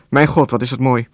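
ordinary delivery

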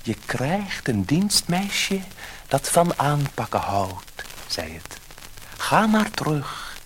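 high fish voice, slowing at the end; magic fish. A narrator's reading. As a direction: grant the wish like a gentle magic fish. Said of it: "het visje vond het niets erg".

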